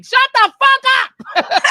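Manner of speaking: high, loud, drawn-out exasperated yell; angry inner outburst. Retold or imitated. Fed-up patient thinking at her screaming roommate, angry yell. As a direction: explode at the screaming roommate, acted out.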